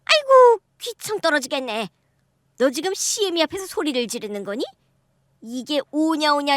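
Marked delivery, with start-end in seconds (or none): 0.1-0.6 a sudden high, loud aigo; mother-in-law yelps at her daughter-in-law's shouting; shrill outcry. Acted. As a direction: cry out high and loud in protest.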